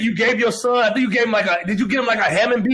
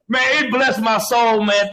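ordinary talk, nothing marked

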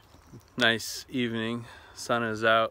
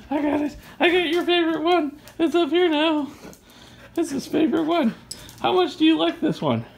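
high-pitched playful taunt; excited teasing. Real, not acted. Owner holding dog's favorite toy, high teasing. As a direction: tease a pet, high and eager.

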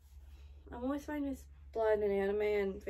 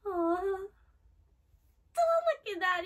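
very high, loud adoring squeal; gushing delight; 7 semitones higher, 5 dB louder than usual. Real, not acted. Viewer spots Nezuko held in his arm, gushing delight. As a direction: coo loudly at something adorable. Gushing over cute moment.

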